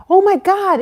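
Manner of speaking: high, loud alarm; reliving a burst of alarm; alarmed outburst. Retold or imitated. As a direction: cry out in dismay. Reliving her alarm about bad person.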